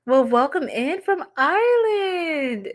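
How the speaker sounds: light mock Irish accent, slow and lilting; playful Irish accent greeting a viewer. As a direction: welcome them in a hammy Irish lilt. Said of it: "I keep doing all these accents".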